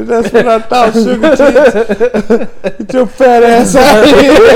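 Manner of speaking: high, loud re-enactment; laughing mock taunt. Friend repeating the soldiers' insults, laughing taunt. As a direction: parrot the taunt while cracking up.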